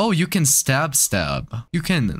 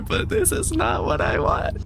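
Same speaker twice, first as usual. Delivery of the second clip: scared horror game streamer. Real, not acted. Panicking while playing horror.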